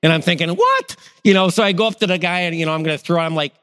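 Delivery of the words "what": sudden high-pitched disbelief; stunned squeak of disbelief; shocked what. Retold or imitated. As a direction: jump way up on the word in shock. Shocked at coupon for chew.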